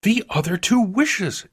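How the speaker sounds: high-pitched, eager answer; urgent reply. A narrator's reading. Wife explaining her idea to her husband, high and urgent. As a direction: press it on him excitedly. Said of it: "she replied rapidly".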